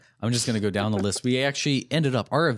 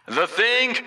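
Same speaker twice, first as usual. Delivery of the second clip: high-pitched announcer call; flavored announcer read; 11 semitones higher than usual. Shown as a demonstration. Host livening up the Avengers list, announcer voice. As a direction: sell the name like a ring announcer. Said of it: "Let me redo that one".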